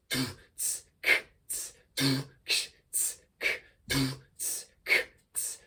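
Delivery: soft beatboxed doof, tss, k loop; quiet beatbox pattern. Shown as a demonstration. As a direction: keep a steady mouth-drum groove. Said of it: "try doof instead of boom now".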